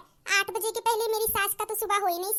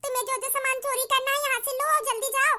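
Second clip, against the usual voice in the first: ordinary talk, nothing marked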